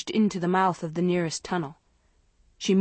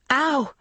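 sharp high yelp of pain; sudden pain cry; 7 semitones higher, 3 dB louder than usual. Acted. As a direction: yelp as the knee scrapes. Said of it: "scraped her knee".

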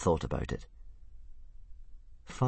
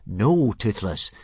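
slow, louder firm no; slow deliberate refusal; 3 semitones higher, 7 dB louder, much slower than usual. Acted. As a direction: set a hard limit. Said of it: "said Hiccup firmly".

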